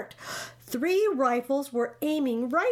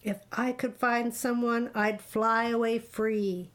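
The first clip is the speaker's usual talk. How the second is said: usual speaking voice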